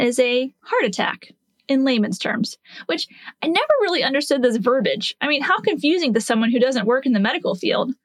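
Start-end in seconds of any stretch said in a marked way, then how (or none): none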